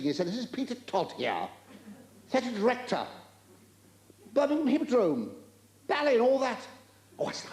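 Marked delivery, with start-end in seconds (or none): 0.3-6.6 Peter Todd, livid. Acted. Livid director reeling off his credentials, high and indignant. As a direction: build each title higher in affronted self-importance. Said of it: "He said, he was livid".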